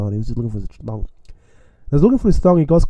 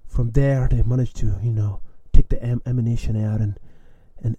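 usual speaking voice

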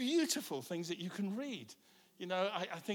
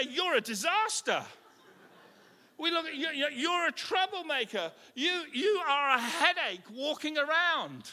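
mock accusation; 8 semitones higher, 4 dB louder than usual. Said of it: "sometimes we look at one another and we say".

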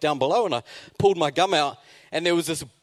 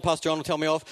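ordinary talk, nothing marked

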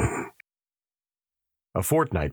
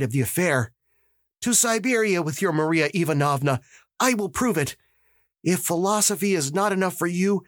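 put-on higher, insistent young voice; eager young detective, higher; 8 semitones higher than usual. Dukovsky begging the magistrate for permission, higher insistent voice. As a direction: demand to be believed, pushing hard.

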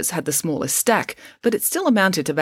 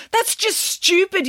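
high, slow, drawn-out yell; angry yell; slower than usual. A narrator's reading. Tria fights with her treehouse team, yelling. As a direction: yell it in exasperation, pitched way up. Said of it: "yelled Tria".

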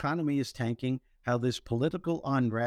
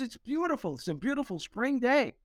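much higher, gushing and giddy; gushing woman; 9 semitones higher than usual. Retold or imitated. Wet woman gushing to grumpy elevator riders, high and giddy. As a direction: gush over the rain like it's sunshine. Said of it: "She goes".

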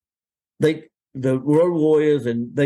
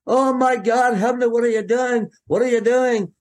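imitating a panicked agent, higher pitched; mock alarm in a higher voice, "what are you doing"; 8 semitones higher than usual. Agent Pat Patterson panicking, retold.

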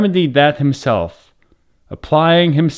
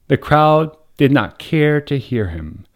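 usual speaking voice

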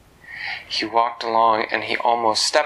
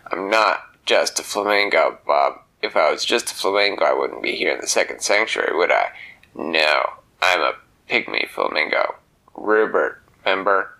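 low, pushy tough-guy bird; gruff flamingo voice; 3 semitones lower than usual. Acted. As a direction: growl it like a grumpy little bird. Flamingo Rupert in story.

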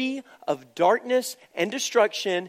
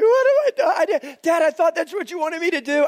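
much higher, louder mock-tearful plea; crying boy; 9 semitones higher, 4 dB louder, faster than usual. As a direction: play the son wailing at his dad. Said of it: "He's like".

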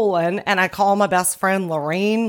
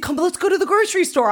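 mock husband nagging fast and higher; quick, higher-pitched imitation of a pestering husband; impatient husband; 9 semitones higher, much faster than usual. Husband interrupts her phone call to go shopping, mock-impatient. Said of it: "He's like".

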